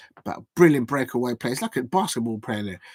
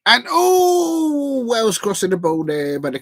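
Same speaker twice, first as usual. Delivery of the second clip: long, high, loud "oh" easing down; real gasp-and-wail as the chance unfolds; excited match reaction; 9 semitones higher, 5 dB louder, slower than usual. Real, not acted. Watch-along host reacts as Wales cross the ball, excited match reaction.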